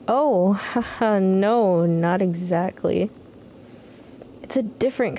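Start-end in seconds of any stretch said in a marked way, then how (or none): none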